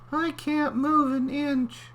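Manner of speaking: very high, slow clam voice. Acted.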